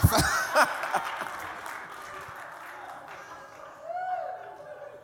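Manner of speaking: breathy high laugh at his own joke; high laughter. Real, not acted. Pastor laughs recalling seeing his future wife. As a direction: laugh freely at the memory.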